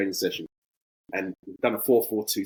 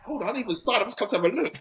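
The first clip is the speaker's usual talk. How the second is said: pitched way up, playing overwhelmed; hammed-up disbelief at the attention; mock flustered protest; 12 semitones higher than usual. Arriving footballer to the paparazzi, high mock-flustered protest.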